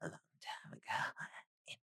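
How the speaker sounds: mysterious hushed whisper; spooky whisper. Acted. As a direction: whisper it like a dark secret.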